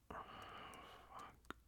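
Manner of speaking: normal talking voice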